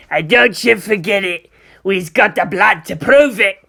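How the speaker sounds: loud, forceful ork bellow; blustering creature voice, punched hard; war boss. Acted. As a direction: bellow it like a brutish warlord. War boss insists to boy.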